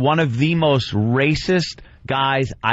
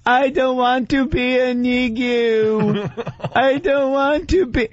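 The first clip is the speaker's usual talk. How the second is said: put-on high, slow little girl's crying plea; 9 semitones higher, slower than usual. Father scolds toddler, she pleads back in a high little-girl voice. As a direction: play a tearful two-year-old, pitched high. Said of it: "with a little girl voice go".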